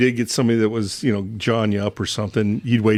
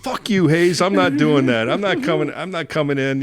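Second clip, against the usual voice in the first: raised, higher-pitched mock defiance; imitated screaming; 6 semitones higher than usual. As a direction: act out a stubborn, yelled refusal, easing off. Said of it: "Chuck's still screaming".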